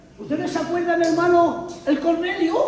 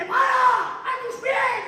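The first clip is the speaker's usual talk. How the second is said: high, stopping the worshipper; a lightly voiced angel halting him; a hint of an urgent angel; angel's voice; 12 semitones higher, much slower than usual. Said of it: "Y Ana dice".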